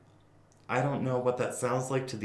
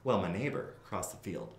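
normal talking voice

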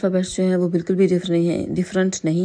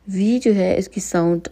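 ordinary talk

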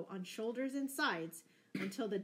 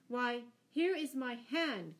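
slow, raised, higher cry; hearty cry; 4 semitones higher, 5 dB louder, slower than usual. A narrator's reading. Stranger offers Robin his hand, slow loud cry. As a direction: cry it out slowly as a pledge. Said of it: "cried the stranger".

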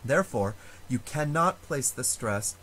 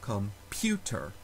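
"computer" slowly, weight on the PU; stress on second syllable; 3 semitones lower, 3 dB quieter, slower than usual. Shown as a demonstration. Teacher demonstrating stress in computer, slow second-syllable stress. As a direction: draw out "computer", leaning on its middle syllable. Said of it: "it is the second syllable that is stressed".

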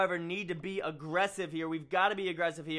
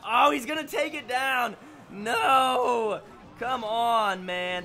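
louder, higher cry of dismay; real frustrated yell at the game; dismayed shout; 6 semitones higher, 5 dB louder, slower than usual. Real, not acted. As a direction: yell as the tower goes down. Tower about to fall.

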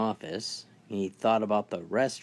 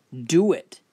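short loud command, much higher; barked order; 6 semitones higher, 7 dB louder than usual. Acted. Robber orders the nurse to drink, loud command. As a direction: snap the order at her.

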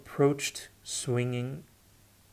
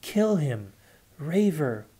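higher, choking fury; spat out; 4 semitones higher, 3 dB louder than usual. A narrator's reading. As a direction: spit the words out as if choking on rage. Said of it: "he spat out".

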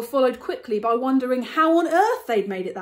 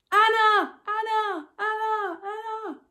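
a high call fading with each echo; distant call echoing; 12 semitones higher, slower than usual. Acted. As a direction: call the name, then let it die away like an echo. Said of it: "I heard a call and then an echo".

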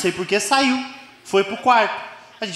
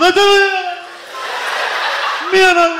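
imitated ecstatic yelling; 11 semitones higher than usual. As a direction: yell it like a racing car zooming by. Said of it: "ele ficava gritando".

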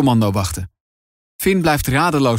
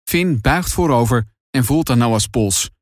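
ordinary delivery, nothing marked

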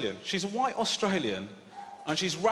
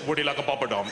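put-on Indian waiter accent, pitched lower; mock Indian accent; 5 semitones lower, much faster than usual. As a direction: do a low, hammed-up Indian accent.

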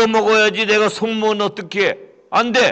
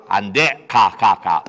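very deep gruff brush-off; gruff refusal; 9 semitones lower, slower than usual. Acted. Rich man shooing the beggar away. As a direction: shoo him away gruffly.

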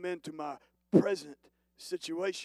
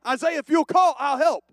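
louder and higher, calling out; raised voice of God insisting; emphatic divine call; 8 semitones higher, 10 dB louder than usual. Acted. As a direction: proclaim it boldly.

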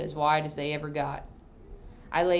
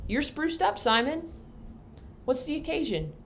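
higher, light schoolteacher voice; a surprised young teacher, pitched up; Miss Rogers; 10 semitones higher than usual. Acted. Teacher greeting Simon at her door, higher and surprised.

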